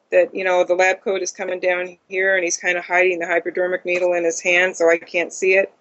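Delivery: ordinary talk, nothing marked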